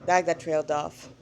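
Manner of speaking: ordinary delivery, nothing marked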